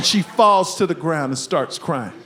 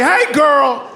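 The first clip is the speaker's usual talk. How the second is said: loud, hammed-up proud call; approving cheer; 5 semitones higher, 5 dB louder than usual. Dad congratulating his daughter, mock proud cheer. Father praises daughter's attack on son.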